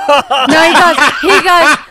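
loud, high, through laughter; words bursting out over a laugh; laughing retelling. Real, not acted. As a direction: crack up while trying to tell it. Cracks up while retelling the story.

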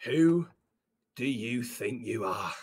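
slow, low, quiet menace; menacing reprimand. Acted. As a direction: draw it out low with long pauses. Boss dressing down employee.

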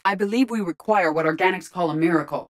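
normal talking voice

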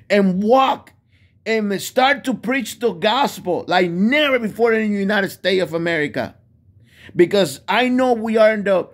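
high, loud sermon cry, pushed hard; fiery preaching. Acted. Preacher urging America back to Jesus, fiery preaching. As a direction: preach it like a revival tent pastor. Preaching at full force to his audience.

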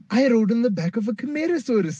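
put-on high, fast, bursting kid voice; overly excited. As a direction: gush it out breathless with pride. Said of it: "very overly excited".

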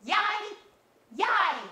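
high squeaky panicked robot yelp; Alpha's "Ay yi yi". Acted. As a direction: punch out each syllable way up high. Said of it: "one punch per word things while going".

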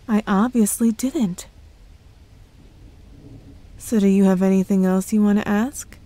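ordinary talk, nothing marked

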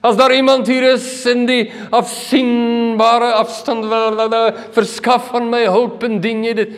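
high, slow, stilted formal appeal; hammed-up polite plea for rescue; mock fancy wording. Shown as a demonstration. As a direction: intone it like an elaborate announcement. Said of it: "dis nie met pragtige woorde".

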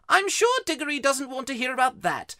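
high, loud aunt shutting down talk; Aunt Letty. Acted. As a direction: cut in firmly, pitched high. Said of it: "She always shuts him up".

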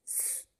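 a long unvoiced hiss, sss; just hiss the S. Shown as a demonstration. Teacher making the S sound for pupils. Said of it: "qui fait chanter le S".

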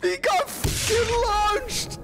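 slow, high shout breaking into laughter; laughing shout. Real, not acted. Watching a teammate launched away, laughing shout.

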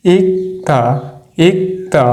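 ইকতা with a crisp k then a clear t, ik-ta, falling; teacher modelling the right form ইকতা; pronunciation demo. Shown as a demonstration. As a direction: say ইকতা slowly and correctly for learners. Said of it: "এর উচ্চারণটা কি আসতেছে?".

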